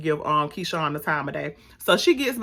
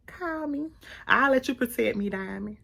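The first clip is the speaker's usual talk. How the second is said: flirty; 5 semitones higher, 5 dB quieter than usual. Acted. Flirting with the actor.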